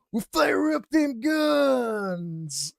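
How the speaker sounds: high, very slow whooping shout; hyped-up shout. Retold or imitated. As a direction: whoop it out like a rowdy bartender. Excited bartender retold in story.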